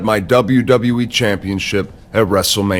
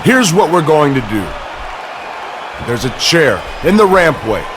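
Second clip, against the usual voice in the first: raised, higher-pitched voice over the crowd; angry commanding; 4 semitones higher than usual. Acted. Angry boss ordering over a crowd.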